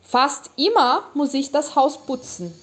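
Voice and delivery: slow, clear, for repeating; measured teacher read-aloud; slow model reading. Shown as a demonstration. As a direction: read it slowly so learners can echo. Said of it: "Eu vou ler ele de novo pra você poder repetir".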